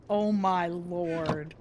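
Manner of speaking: ordinary delivery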